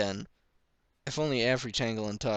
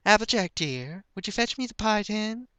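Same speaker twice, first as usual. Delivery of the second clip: much higher, sweet motherly request; gentle mother; 12 semitones higher, 4 dB louder than usual. A narrator's reading. Ma sweetly asking Applejack for the pie tin. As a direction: ask your daughter kindly like a mother.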